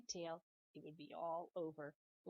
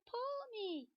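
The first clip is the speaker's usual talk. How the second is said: high little pigtail voice asking. Acted. Pigtail asking Paul to pull it, high and pleading. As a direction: coax him in a tiny high voice.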